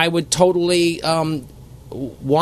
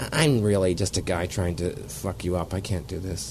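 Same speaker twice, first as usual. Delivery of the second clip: much softer, dropping away; quiet confession; 9 semitones lower, 9 dB quieter than usual. Retold or imitated. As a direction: admit it under your breath. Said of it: "I just went".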